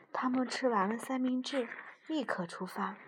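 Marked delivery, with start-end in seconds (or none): none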